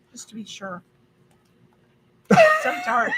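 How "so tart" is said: high squeal at the sourness; sour shock. Real, not acted. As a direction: yelp from the tart bite. Reacting to sour taste.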